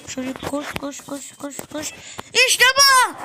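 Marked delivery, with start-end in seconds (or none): 2.5-3.2 high, loud "İşte bu" cheer; triumphant shout. Real, not acted. Gamer clearing a tough spot, loud triumphant cheer. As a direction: cheer the win. Success in the game.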